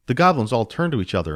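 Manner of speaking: ordinary delivery, nothing marked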